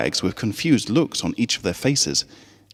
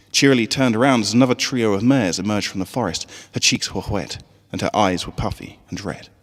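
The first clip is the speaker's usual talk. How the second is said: ordinary delivery, nothing marked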